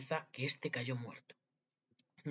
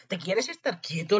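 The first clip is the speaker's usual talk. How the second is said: loud, raised, exasperated question; scolding; 6 semitones higher, 7 dB louder, slower than usual. A narrator's reading. Wolf to the noisy boy inside him, scolding.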